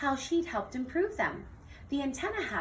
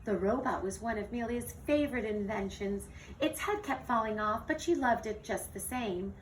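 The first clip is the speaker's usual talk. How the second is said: ordinary delivery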